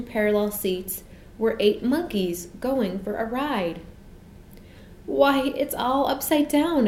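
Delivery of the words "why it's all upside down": high, slow exclamation of surprise; astonished child's exclamation; exclaiming. A narrator's reading. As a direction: draw it out high in surprise, falling at the end. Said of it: "exclaimed Jane".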